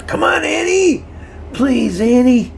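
high, loud desperate plea; frantic high yell; crying out. A narrator's reading. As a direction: shout it out high, begging her. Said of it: "Davy cried out".

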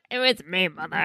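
low, mock-dramatic confession. Co-host quoting a confession to mother, mock dramatic. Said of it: "very dramatic".

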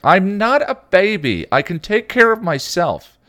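high, loud, slow furious shout; enraged. A narrator's reading. Dawn raging at Spike, loud furious shout. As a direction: scream at him in a rage. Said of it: "Dawn flew into a rage".

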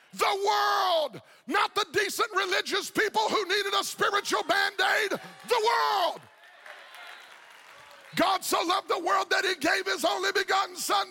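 full-force preaching. Acted. Pastor urging the congregation that God wants everyone saved, full-force preaching. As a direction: preach it out to the whole church.

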